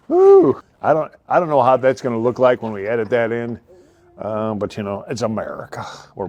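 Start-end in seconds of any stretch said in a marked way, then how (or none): 0.1-0.6 high loud woo; cheering at himself; whoop. Real, not acted. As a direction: let out a whoop.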